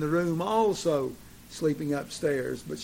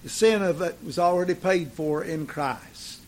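ordinary talk, nothing marked

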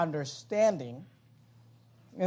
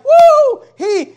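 high, loud whooping cheer; giddy shout of victory; excited whoop; 11 semitones higher, 15 dB louder than usual. Retold or imitated. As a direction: cheer like a thrilled disciple. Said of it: "They're all excited".

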